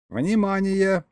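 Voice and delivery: sudden high bark of alarm; barked command. A narrator's reading. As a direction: snap out the alert. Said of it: "гаркнул вдруг Смушиилс".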